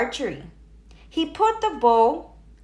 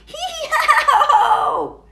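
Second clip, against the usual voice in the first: much higher, louder braying laugh; hee-haw laugh; 7 dB louder than usual. A narrator's reading. Campers laugh at Froggy, a loud braying hee-haw. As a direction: bray it out like a donkey laughing.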